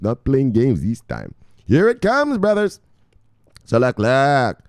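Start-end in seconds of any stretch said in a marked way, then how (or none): 1.6-2.8 high-pitched, excited call; excited hype. Real, not acted. Host hyping his brothers for the news, excited. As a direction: announce it high to rally them.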